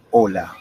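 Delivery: HOLA begun straight on the O; silent H. Shown as a demonstration. Teacher giving an example, HOLA with a silent H. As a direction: drop the H in HOLA. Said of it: "The H is always silent".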